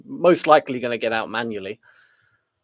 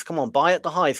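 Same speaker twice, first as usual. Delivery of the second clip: ordinary talk, nothing marked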